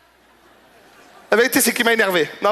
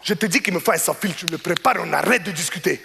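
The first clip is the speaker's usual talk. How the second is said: accusing customer; 2 semitones higher than usual. Retold or imitated. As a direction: push him around and cut the talk short.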